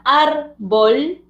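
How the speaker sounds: "árbol" split into two, ár-bol; syllable by syllable. Shown as a demonstration. Teacher showing syllables ending in consonants, "árbol" in two separated syllables. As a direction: hold each syllable of "árbol" apart. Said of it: "cada una de sus sílabas".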